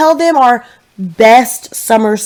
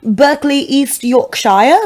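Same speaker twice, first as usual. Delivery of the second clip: slow British place name; put-on British, drawing out the county; British accent; 3 semitones higher, slower than usual. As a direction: claim an English hometown in British. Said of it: "we would tell people we were from".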